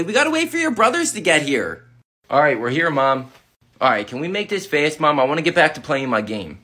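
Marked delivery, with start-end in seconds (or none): 2.3-6.6 put-on much lower, impatient teen; can't be bothered brother; bored teen brother. Teen brother tells mom to hurry, low and bored.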